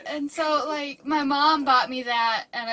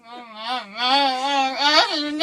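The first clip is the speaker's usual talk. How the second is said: wordless groggy mumbles, building; low hums rising into a whine; sleepy groaning; 3 semitones lower, much slower than usual. Acted. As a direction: grunt as if dragged from sleep. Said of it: "I'm, like, asleep".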